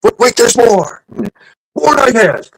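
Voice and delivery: high-pitched TV pitchman shout; infomercial pitchman. Retold or imitated. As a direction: pitch it like a late-night ad. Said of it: "the M D A telethon".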